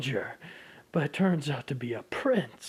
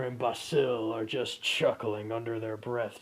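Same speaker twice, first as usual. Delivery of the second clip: ordinary talk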